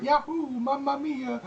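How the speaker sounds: high, slow cartoon plumber cry; Mario impression. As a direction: yell it like a bouncy Italian plumber. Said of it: "Mario's voice actor going".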